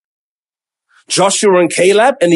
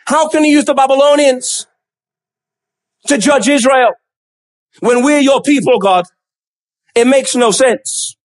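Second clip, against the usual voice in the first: higher-pitched, baffled appeal to God; acted disbelief, stammering into protest; incredulous; 3 semitones higher than usual. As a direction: plead with God for an explanation. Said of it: "hear the incredulity in his voice".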